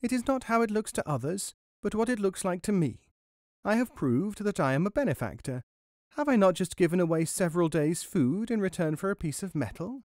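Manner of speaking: high, self-important little mouse; mouse talking to himself. A narrator's reading. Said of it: "said Guru to himself".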